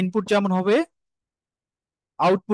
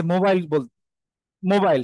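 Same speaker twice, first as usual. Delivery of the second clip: ordinary delivery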